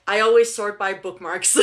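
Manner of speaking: laughing through the correction. Real, not acted.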